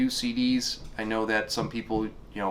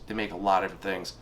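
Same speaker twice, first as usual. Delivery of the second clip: ordinary talk, nothing marked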